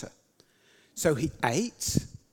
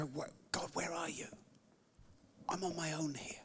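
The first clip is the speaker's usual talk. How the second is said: hushed whisper, lost and alone; lonely whispered prayer; 14 dB quieter than usual. Retold or imitated. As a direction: whisper it as if no one hears.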